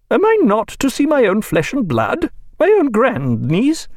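high, indignant protest; outraged at being barred from her grandniece; indignant Mrs Williams. A narrator's reading. Mrs Williams protesting to Stephen, indignant and high.

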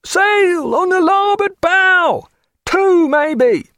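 high, slow, drawn-out hail from the masthead; a lookout howling down to the deck, hesitant and apologetic. A narrator's reading. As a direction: call it down long and unsure, like a lookout who expects no thanks. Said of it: "in a hesitant, deprecating howl".

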